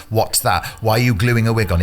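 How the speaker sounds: usual speaking voice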